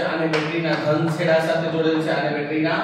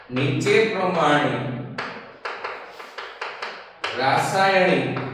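slow dictation with long pauses. Real, not acted. Teacher dictating notes to students, slow and broken up. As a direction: dictate phrase by phrase, repeating for note-takers.

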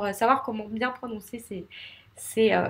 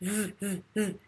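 voiced th buzz; 2 semitones lower, 10 dB quieter than usual. Shown as a demonstration. Teacher isolating the vibrating th, a held buzz. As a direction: hold a buzz on the tongue tip. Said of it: "C'est comme un Z".

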